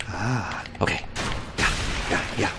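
ordinary talk, nothing marked

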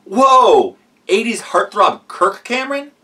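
thrilled, loud and high; gushing fanboy delight; excited. Pretend. Surprise guest is Kirk Cameron.

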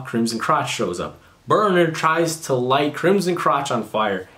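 ordinary talk

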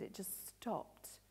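soft near-whisper, slow and hushed. Acted. Narrator tells how the wind stopped, hushed whisper. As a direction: let the silence land. Storm suddenly falls silent.

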